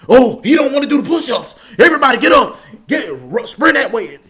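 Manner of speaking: loud, high barking order; drill instructor yell. Retold or imitated. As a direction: bark it like a drill sergeant. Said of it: "the drill instructor would be like".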